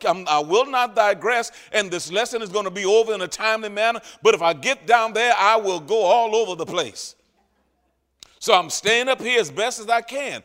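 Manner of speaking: loud, rising sermon delivery to the congregation; full-force preaching. Acted. Preacher driving home the point to his church, loudly. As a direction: preach it hard and high over the room.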